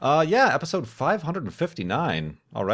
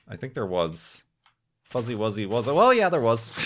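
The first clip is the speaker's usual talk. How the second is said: normal talking voice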